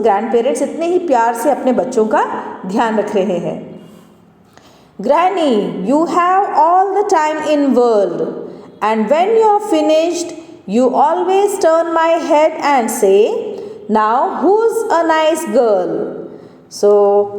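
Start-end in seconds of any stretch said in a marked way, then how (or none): 5.0-16.1 very slow, higher-pitched poem reading; poem recitation. Acted. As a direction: recite it line by line, drawing the words out. Reads the poem aloud. (धीमी, ऊँची आवाज़ में कविता पाठ)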